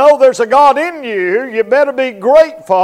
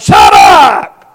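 louder barked shut up; angry shout; 2 semitones lower, 9 dB louder than usual. Retold or imitated. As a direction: cut the conscience off with a yell. Sinner silencing conscience.

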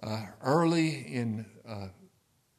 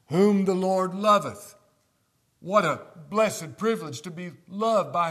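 preaching loud; 6 semitones higher, 9 dB louder than usual. Real, not acted. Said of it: "repeats verse at full force".

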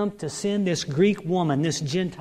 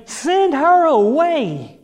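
high, loud, drawn-out plea; preacher acting out exasperated begging; begging demand; 8 semitones higher, 6 dB louder, slower than usual. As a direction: stretch out the demand, pitched up. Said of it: "begged him, saying".

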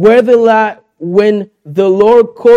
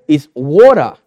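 "water" said lower, with Australian vowels; 5 semitones lower than usual. Retold or imitated. Daughter correcting dad's "water" the Australian way, lower. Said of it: "she's correcting my accent".

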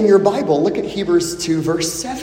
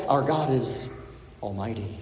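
dropping to a near-hush on almighty; reverent hush; 10 semitones lower, 11 dB quieter than usual. Acted. Preacher to congregation about God's power, hushed reverence. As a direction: let the word fall away in awe.

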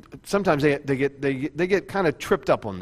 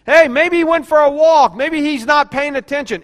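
higher and louder jeering calls; mocking taunt; 8 semitones higher, 8 dB louder than usual. Elijah taunting the prophets of Baal, mocking and loud. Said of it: "he was mocking them".

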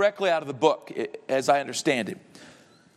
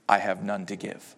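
much lower, softer mocking reply; devil's cold answer; 9 semitones lower, 5 dB quieter than usual. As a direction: answer flat and final. Resounding answer from hell.

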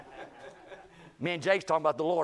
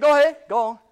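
1 daring; 11 semitones higher, 13 dB louder than usual. Retold or imitated. Daring the coworker to hit.

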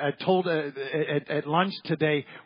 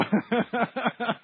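short laugh; 8 semitones higher than usual. Real, not acted. Laughing at shoe size.

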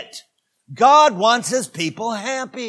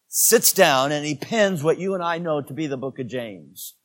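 ordinary delivery

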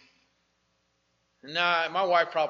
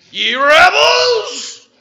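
very slow, high, loud shout with a laugh; fired-up prophet yelling at rebels; excited Moses shout; 14 semitones higher, 19 dB louder, much slower than usual. Acted. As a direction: stretch it out and roar. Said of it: "He's excited".

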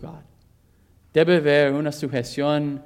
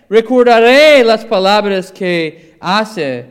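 forceful scripture reading; 6 semitones higher, 9 dB louder than usual. Acted. Preacher reading John's words to the church, forcefully. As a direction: proclaim the verse boldly.

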